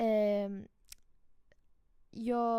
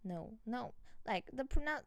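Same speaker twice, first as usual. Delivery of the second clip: normal talking voice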